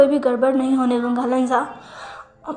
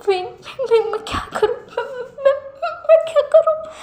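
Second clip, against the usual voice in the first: high, breaking sobbing plea rising to a wail; a nephew afraid of losing his uncle, sobbing to his partner; crying; 5 semitones higher than usual. A narrator's reading. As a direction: stutter and sob, voice climbing into a final cry. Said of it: "लैंजन रोते हुए कहता है".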